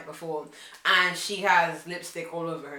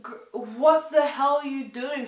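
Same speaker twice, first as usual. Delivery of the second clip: higher, louder outraged snap; shocked scolding; 7 semitones higher, 5 dB louder than usual. Retold or imitated. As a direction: tell her off in disbelief. Stopping drunk friend grabbing stranger.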